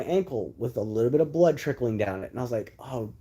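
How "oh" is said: whispered under breath, slow; inner groan. Retold or imitated. Snake-bitten boy cursing to himself in a whisper. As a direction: breathe it out like a silent curse. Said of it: "Like, in my brain".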